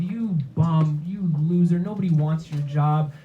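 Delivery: usual speaking voice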